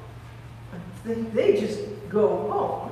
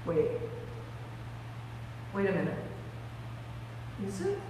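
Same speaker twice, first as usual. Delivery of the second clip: very soft, halting disbelief; hushed realization; 4 semitones lower, 12 dB quieter, much faster than usual. Acted. Mary starting to recognise Jesus, hushed and halting. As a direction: barely voice the dawning hope.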